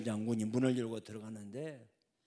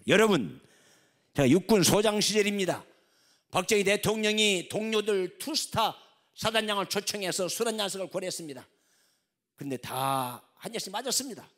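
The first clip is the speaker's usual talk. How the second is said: loud, higher preaching voice; full-force preaching; 5 semitones higher, 9 dB louder than usual. Acted. Preacher voicing the general's testimony to the congregation: fervent preaching. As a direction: preach it out to the hall.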